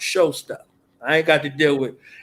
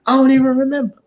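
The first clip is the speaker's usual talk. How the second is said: imitating a fan; 10 semitones higher, 5 dB louder, much faster than usual.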